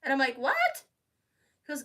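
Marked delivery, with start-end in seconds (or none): 0.4-0.8 a high, loud stunned squeak; shocked what. Retold or imitated. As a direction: blurt it out in disbelief. Shocked reaction to request.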